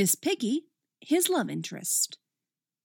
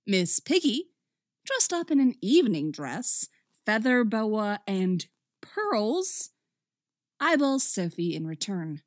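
usual speaking voice

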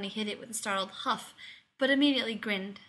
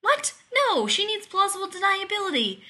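high, loud panicked protest; spluttering; 11 semitones higher, 8 dB louder than usual. A narrator's reading. Rodney horrified that Roddick will tell Elizabeth, spluttering high and loud. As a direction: splutter it out in alarm. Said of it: "Rodney spluttered".

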